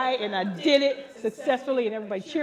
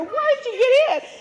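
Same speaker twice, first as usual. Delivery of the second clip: high, loud laughing reproach; mock-scold the husband; 13 semitones higher, 6 dB louder than usual.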